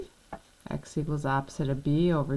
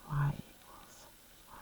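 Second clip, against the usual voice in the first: whispering; 12 dB quieter than usual. Real, not acted. Teacher whispers answer aside.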